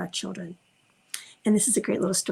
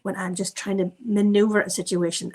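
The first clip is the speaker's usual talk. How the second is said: ordinary talk, nothing marked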